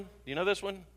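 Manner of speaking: ordinary delivery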